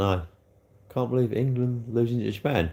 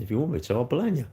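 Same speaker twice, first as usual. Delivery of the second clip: usual speaking voice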